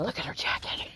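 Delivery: unvoiced, hushed aside; whispering. Real, not acted. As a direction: keep it to a whisper. Secret remark about a stranger.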